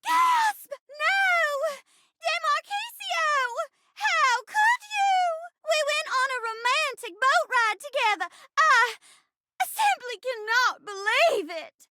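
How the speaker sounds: high, theatrical stage shock; hammy heartbreak, drawn out and high; melodramatic betrayed heroine. Acted. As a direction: wail it as a wronged stage bride. Acts the betrayed bride.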